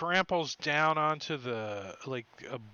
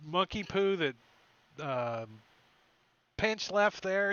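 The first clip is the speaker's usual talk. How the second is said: ordinary talk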